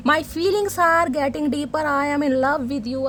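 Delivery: drawn-out, very slow lovestruck English; slow dreamy confession. Acted. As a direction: stretch every word like a sighing lover. Card message read as confession. (बहुत धीमा, डूबा हुआ प्यार भरा इज़हार)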